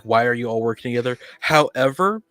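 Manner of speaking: ordinary delivery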